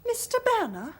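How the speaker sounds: loud, high-pitched surprise; taken aback and loudly indignant; astonished. Acted. Astonished at the announced guest.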